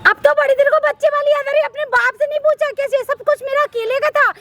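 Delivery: high, heated quarrelling; angry retort. Acted. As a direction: fire back at father-in-law. Wife quarrels back heatedly. (ऊँची आवाज़ में गुस्से से पलटवार)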